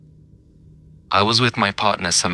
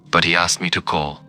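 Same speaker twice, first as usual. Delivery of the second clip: ordinary delivery, nothing marked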